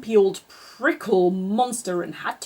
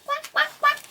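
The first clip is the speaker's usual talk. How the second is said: high squeaky quacking; duckling quacks. Acted. Reading to a child, quacking as the duckling.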